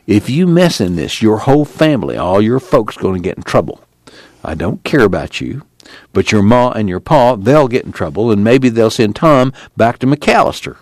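ordinary delivery, nothing marked

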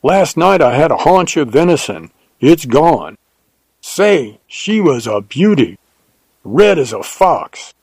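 keyed-up Texan telling news; excited. A narrator's reading. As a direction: report the find eagerly in a Texas voice. Jim excitedly reports a lioness.

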